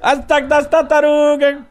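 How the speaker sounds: high, slow mock arcade shout; video game attack cry. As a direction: copy a garbled fighter's battle call.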